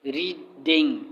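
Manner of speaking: "Reading" broken after री, before ding; syllable by syllable. Shown as a demonstration. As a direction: pronounce "reading" as two distinct beats. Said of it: "abstraction आ गया".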